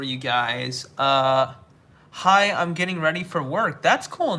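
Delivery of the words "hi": ordinary delivery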